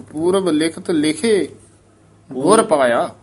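ਲਿਖੇ followed by a marked break; pause after ਲਿਖੇ. Shown as a demonstration. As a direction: clear halt after ਲਿਖੇ. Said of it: "ਲਿਖੇ ਤੇ ਲਾ ਦੋ ਠਮਕ".

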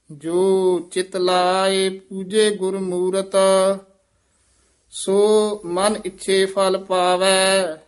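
measured chant-like reading with drawn-out line endings; scripture recitation. Shown as a demonstration. Teacher reciting Gurbani for students, measured chant. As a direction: intone each line on a level note.

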